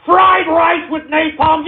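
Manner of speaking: angry shout. Real, not acted. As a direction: blow up at the name. Exploding at the troll name.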